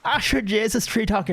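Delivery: high, playful Irish lilt; mock Irish accent. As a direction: tease by echoing a thick Irish accent. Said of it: "fairly fucking Irish accent you got going there".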